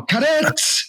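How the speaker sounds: high, posh mock call of carrots. Mimicking Jet calling out carrots in a posh voice. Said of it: "in a proper class voice".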